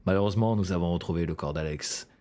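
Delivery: low, grave narrator voice; documentary voice-over. Shown as a demonstration. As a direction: read it like a somber true-crime narrator. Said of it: "petite voix off".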